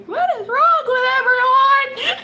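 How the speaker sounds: shrill-high, loud exasperated squeal; a high, loud cry of disbelief. Retold or imitated. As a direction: squeal it out in mock despair. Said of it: "I'm going".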